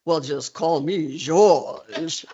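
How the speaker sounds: slow, drawn-out presidential impersonation; imitating the president. President offering his first name to reporter, imitated slowly. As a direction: draw it out like a folksy punchline. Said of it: "And then he says".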